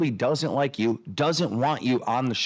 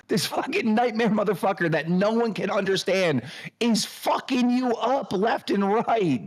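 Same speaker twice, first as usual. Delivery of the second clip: exasperated rant; 7 semitones higher than usual. Real, not acted. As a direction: hammer it home, pitched up. Ranting about bullying.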